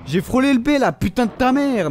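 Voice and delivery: high, loud furious cursing; raging yell. Real, not acted. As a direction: spit the insult out in rage. Gamer raging at missed button. (rage de joueur, insultes criées)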